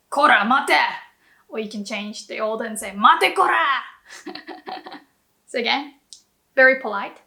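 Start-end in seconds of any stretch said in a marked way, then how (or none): 0.1-0.8 loud, menacing Kora, mate; scary yell. Shown as a demonstration. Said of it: "you can sound very scary". 2.9-3.8 loud, higher Mate, kora; threaten, then spit out kora; scary yell. Shown as a demonstration. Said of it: "you can sound very scary".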